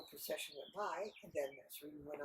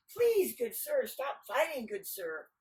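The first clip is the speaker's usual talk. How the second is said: raised plea; 6 semitones higher, 6 dB louder, slower than usual. Acted. Men demonstrate what to say.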